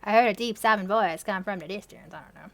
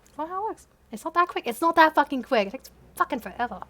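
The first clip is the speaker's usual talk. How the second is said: ordinary talk, nothing marked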